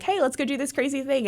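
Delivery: a lightly voiced eager invitation; excited friend's invite. Friend texting an invite, lightly excited. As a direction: pitch it up like a friend pitching fun. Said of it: "someone texts you and is like".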